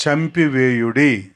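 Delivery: slow, syllable by syllable; slow syllable drill. Shown as a demonstration. Preacher drilling the crowd slowly on a hard word. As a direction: spell it out slowly in the mouth. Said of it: "ఇది ఎవరికన్నా నోరు తిరగట్లేదా?".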